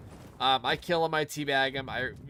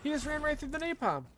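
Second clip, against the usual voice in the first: disbelief; 11 semitones higher than usual. Real, not acted. Enemy survives napalm in game.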